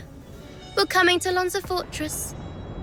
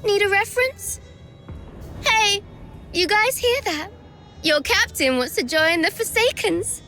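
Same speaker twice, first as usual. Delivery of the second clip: higher, singsong mocking call to the crowd; mocking taunt; 5 semitones higher than usual. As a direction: taunt him in front of everyone. Taunting, calling out to others.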